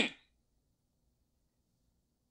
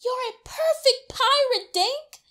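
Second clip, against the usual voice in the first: higher praising big brother; higher, cheerful praise; 9 semitones higher than usual. A narrator's reading. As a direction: cheer him on.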